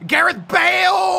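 high, stretched-out wail of the name; long anguished yell; dismayed shout. Real, not acted. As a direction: cry out the scorer's name in dismay. Crying out as opponent scores.

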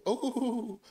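high drawn-out imitated coo; dove's crying coo. Preacher showing how Hezekiah cried like a dove, high cooing. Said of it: "a dove makes a song".

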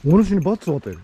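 warrior monk voice. Pretend. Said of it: "わしは僧兵じゃ".

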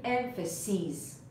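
emphases ending in a long "-seez"; plural long-ee ending. Shown as a demonstration. Teacher modelling the plural for learners, emphases with drawn-out ee ending. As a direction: slowly say emphases as "EM-fuh-seez". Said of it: "کافیه این آی رو تبدیل کنی به ای".

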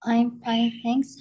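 ordinary delivery

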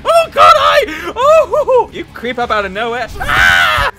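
high, loud laughing screams; jumped by a zombie, screaming; scared shrieks. Real, not acted. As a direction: shriek in fright, then laugh. Zombie ambush.

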